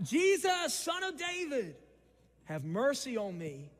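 Jesus called high and loud. Shown as a demonstration. Blind man calls to Jesus, high loud call. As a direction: cry it out to be heard. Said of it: "So he called out".

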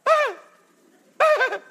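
loud, high comic shriek; mock panicked scream. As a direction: let out two short, high screams of fright. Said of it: "I used to scream out".

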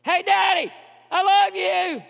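put-on high, loud child's call; yelling again. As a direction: shout it after the dad from far away. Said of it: "That little boy yells again".

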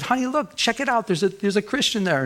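high-pitched, eager calling of attention; retold excited discovery. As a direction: act out spotting something and pointing it out, pitched well up. Retelling pointing out a find.

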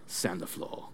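quiet, low, clipped-vowel sensei command; Mister Miyagi. Acted. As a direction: softly intone it like an old karate master. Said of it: "then Mister Miyagi says".

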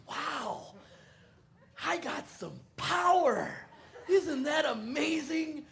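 high, loud, slow amazement; young Tom thrilled at his power; gullible excitement. Retold or imitated. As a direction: marvel like a kid who believes it. Young Tom thinks he has powers.

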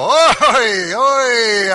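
Oi! barked loud and high; Hebrew oi. Shown as a demonstration. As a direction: cry Oi! as a sharp, loud lament. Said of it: "The next time you get in trouble".

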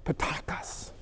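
hushed whisper for emphasis; soft, voiceless reveal. Real, not acted. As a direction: drop to a whisper on the key word. Hushed key word after a pause.

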